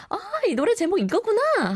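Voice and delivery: high-pitched, quick 'aha' moment; sudden realization. Acted. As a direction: act out a listener suddenly getting it. Acting listener guessing the song.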